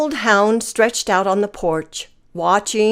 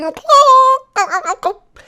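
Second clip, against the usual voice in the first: hen clucking; slower than usual. A narrator's reading. Hens squawk in the hen house with high clucks. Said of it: "squawking and clucking".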